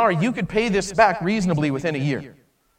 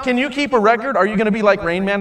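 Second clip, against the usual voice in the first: ordinary delivery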